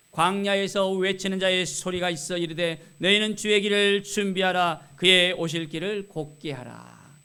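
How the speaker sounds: loud, declaimed Bible verse; scripture reading. Acted. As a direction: proclaim the verse to a congregation. Reads scripture aloud to congregation.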